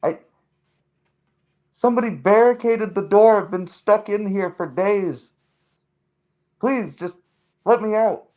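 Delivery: high, desperate, muffled pleading; begging through the floor. Acted. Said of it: "The muffled voice returns".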